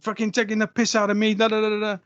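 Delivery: high-pitched, irate Saint Lucian man; Saint Lucian accent. Retold or imitated. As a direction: imitate an angry coworker's Saint Lucian accent. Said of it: "I can do a Saint Lucian accent".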